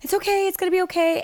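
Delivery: a mother's shaky reassurance, pitched high; reassuring through tears. Retold or imitated. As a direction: comfort them, it's going to be fine. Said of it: "and I was like".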